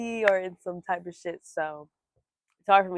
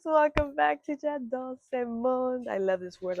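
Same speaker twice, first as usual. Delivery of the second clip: put-on French accent; 5 semitones higher than usual. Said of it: "Also, I do accents".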